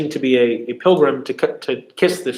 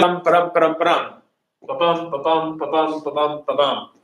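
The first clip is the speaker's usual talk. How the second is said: weak "ba", stronger "bum", evenly repeated; steady ba-BUM pulse, stressing every "bum" and "dum"; iambic beat demo. Shown as a demonstration. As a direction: chant the rhythm in nonsense syllables. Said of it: "and the rhythm was".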